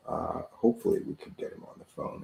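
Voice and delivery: quick muttered aside under the breath; whispered aside. Real, not acted. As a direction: drop to a hushed, fast aside. Host aside while dialing guest.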